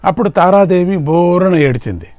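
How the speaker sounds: ordinary talk, nothing marked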